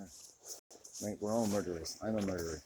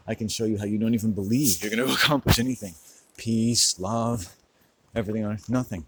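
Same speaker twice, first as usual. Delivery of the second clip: ranting louder; 2 semitones higher, 7 dB louder than usual. Real, not acted. Vlogger ranting at viewers, louder. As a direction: raise it to a rant, louder and laughing.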